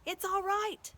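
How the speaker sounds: high, loud glad call; joyous shout. Acted. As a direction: reassure them with a joyful shout. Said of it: "shouted Aslan joyously".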